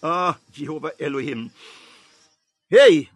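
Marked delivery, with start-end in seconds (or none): 2.7-3.1 a sudden, very loud cry of Hey; fervent preacher's outburst; loud shout. Real, not acted. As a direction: shout it out to the congregation. Preacher's exclamation.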